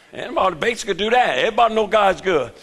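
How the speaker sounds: usual speaking voice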